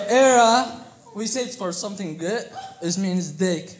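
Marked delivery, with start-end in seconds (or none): 0.1-0.7 loud, higher, punched out; shouted demo word. Shown as a demonstration. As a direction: throw the word at the crowd.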